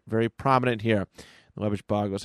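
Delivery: ordinary delivery, nothing marked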